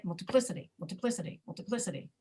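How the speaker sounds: "multiplicity" tossed off with weak vowels, only PLI clear; reduced vowels. Shown as a demonstration. Teacher not depending on "uh", "multiplicity" reduced. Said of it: "I can say".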